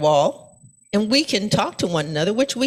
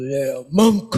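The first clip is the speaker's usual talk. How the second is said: brusque warrior voice; 7 semitones lower than usual. Retold or imitated. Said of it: "in a tone of voice, who is ... used to talking".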